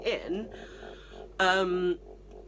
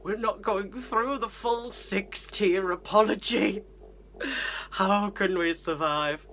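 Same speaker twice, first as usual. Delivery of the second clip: slow, overdramatic dismay; mock horror; slower than usual. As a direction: lament a breach of manners as a catastrophe. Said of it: "We felt terrible doing it".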